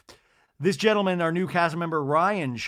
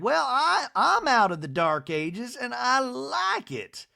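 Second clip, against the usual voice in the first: high, slow, emphatic matron; Miss Patricia; 9 semitones higher, slower than usual. Retold or imitated. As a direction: imitate a proud lady digging in, stretching the words. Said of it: "Miss Patricia's like".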